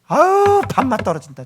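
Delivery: loud, high groan of disgust, dropping after; acted exasperated revulsion; disgusted exclamation. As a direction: recoil loudly from someone selfish. Reacts in disgust to selfish blamer.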